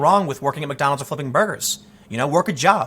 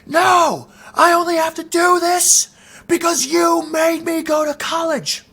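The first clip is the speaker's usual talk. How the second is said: much higher, the friend snapping back at his mom; retold angry snap; 12 semitones higher, 4 dB louder, slower than usual. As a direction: snap it high and tense, retelling the friend's outburst. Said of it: "And he snapped".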